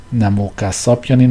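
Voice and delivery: ordinary delivery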